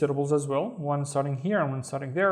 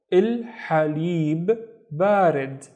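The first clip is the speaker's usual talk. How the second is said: slow, clear pronunciation; much slower than usual. Shown as a demonstration. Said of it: "teacher models the phrase".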